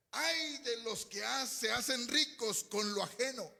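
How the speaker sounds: high, slow proclamation of woe; exclaimed scripture reading. Real, not acted. Preacher reads verse six to the church, slow exclaimed warning.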